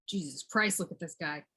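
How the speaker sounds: soft, low exclamation to yourself; quiet aside. Pretend. Marvelling at this guy to oneself, quiet aside. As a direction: drop it under your breath. Aside about the one addressed.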